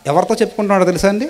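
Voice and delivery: normal talking voice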